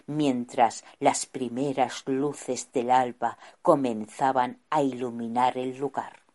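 usual speaking voice

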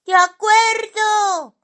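very high, loud, drawn-out agreement; high cartoonish yell; eager shout. A narrator's reading. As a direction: shout it in a squeaky little voice. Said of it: "gritó Simón".

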